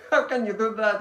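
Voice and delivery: put-on high, loud, outraged child's cry; upset little girl. Daughter protesting to her father who blew out her candles, high tearful outcry. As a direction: wail it like a hurt six-year-old. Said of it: "And she starts weeping".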